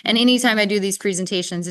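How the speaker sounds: ordinary delivery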